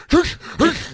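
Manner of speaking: high mock hushing; hushing the dog. Owner hushing the barking dog, high mock hush. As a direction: shush the dog half-heartedly. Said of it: "He's like".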